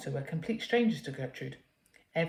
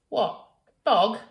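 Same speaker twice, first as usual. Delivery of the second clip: loud, high, cheeky innocent question; mischievous; 4 semitones higher, 9 dB louder than usual. Acted. Girl teasing prim mother, mischievous. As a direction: tease her mother with it. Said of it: "Gertrude would answer mischievously".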